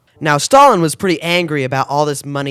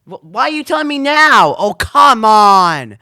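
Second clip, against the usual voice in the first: annoyed phone argument; 5 semitones higher, 4 dB louder, slower than usual. Acted. Arguing with Karen on phone.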